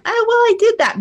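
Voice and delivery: hammed-up whiny mom; mom brushing off advice. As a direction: mimic a dismissive mother. Voicing mom dismissing advice.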